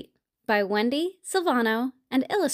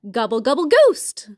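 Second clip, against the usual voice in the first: gobbling turkey; 6 dB louder than usual. Acted. Turkey announces his costume idea.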